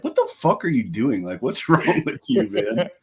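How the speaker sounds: incredulous mock scolding, pitch climbing; retelling a bandmate's outburst at his cracking voice; bandmate yelling at him. Mild incredulous yell. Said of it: "and Arian's like".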